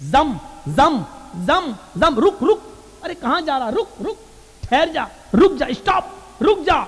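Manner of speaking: short swooping cries, pitch jumping up; mock frantic calls. Preacher imitating Hajra halting the spring, mock frantic calls. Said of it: "चीख चीख कर कहने लगी".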